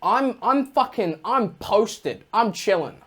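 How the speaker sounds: ordinary talk, nothing marked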